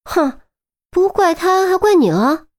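high-pitched scoffing comeback; huff, then snap back sharply; annoyed, incredulous challenge; indignant retort. A narrator's reading. Glaring retort to the defence.

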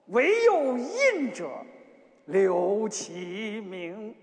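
ordinary delivery

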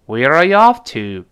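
WHERE ARE YOU OFF climbs loudly, "to" drops; loud rise and fall. Shown as a demonstration. Teacher leads students aloud in intonation practice, rising then falling. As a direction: call it out, soaring to OFF and falling on "to". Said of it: "我们大声来一遍".

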